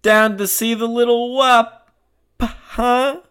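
hammed-up mocking tough guy; scornful Floyd. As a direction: sneer like an old-time city cop.

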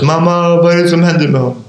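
daughter's frightened question, hurried and high; scared daughter. Retold or imitated. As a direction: voice the scared daughter asking mamma. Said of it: "Jag hör en annan röst".

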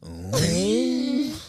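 a high crooning ooh, copying the singer; imitated crooner ooh. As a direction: coo it like the lounge singer. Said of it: "when he goes".

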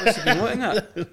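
laughing mock outrage. Mock shocked mates, laughing.

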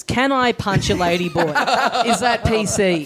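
laughing through a mock question. Real, not acted. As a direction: giggle the question out.

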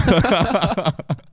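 loud high-pitched laugh; high loud cackle; high laughter. Real, not acted. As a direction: crack up laughing. Laughing at own story.

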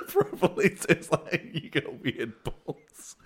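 laughing. Real, not acted. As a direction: barely get the words out for laughing. Giggling at an imagined heckle.

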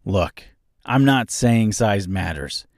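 ordinary delivery

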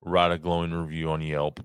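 ordinary talk, nothing marked

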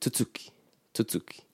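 softly said "tutuki", stressed on middle tu; quiet "tutuki" with a quick first syllable; stress on second syllable. Shown as a demonstration. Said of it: "the stress is on the second syllable".

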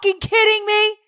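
high yelled outrage; furious high disbelieving yell; screaming in disbelief. Retold or imitated. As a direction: scream it at him across the field. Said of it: "I'm screaming to Croy".

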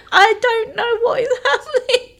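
high, laughing through the words; half-laughing, half-crying panic; laughing helplessly. Retold or imitated.

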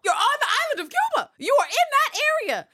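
very high, loud, rapid-fire protest; indignant screaming. Real, not acted. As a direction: shout it in outraged disbelief. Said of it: "I did scream quite a bit".

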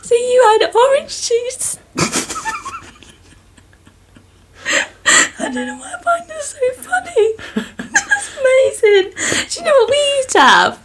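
high-pitched giggling through the words; can barely get the words out for laughing; laughing fit. Real, not acted. Host cracking up over a cousin's orange juice starter, laughing helplessly. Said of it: "I need to calm myself down".

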